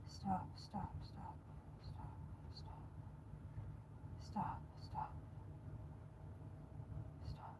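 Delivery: gasping panic attack whisper; breathless, panicking, barely voiced; hyperventilating. Real, not acted. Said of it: "I'm having a panic attack".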